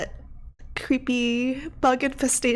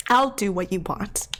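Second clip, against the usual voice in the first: firm, exasperated surrender at full voice; forceful giving in; 2 semitones lower than usual. Acted. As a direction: give in sharply, mid-argument. Acted quarrel, line said twice.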